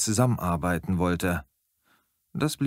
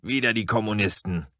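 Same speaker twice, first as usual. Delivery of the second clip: blustering. A narrator's reading. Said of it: "polterte Sam".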